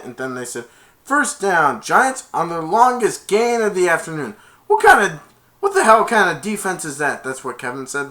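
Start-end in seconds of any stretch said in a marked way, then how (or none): 1.0-4.3 raised, loud game announcer voice; announcer call. Retold or imitated. Stadium announcer calling the first down, raised loud voice. 4.7-7.2 high, loud exasperated shout; angry outburst. Retold or imitated. Kevin yelling at his defense, loud exasperated shout.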